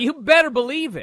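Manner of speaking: high, loud echo of the caller; excited echo. Retold or imitated. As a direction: shout it back gleefully. Host echoes caller's catchphrase.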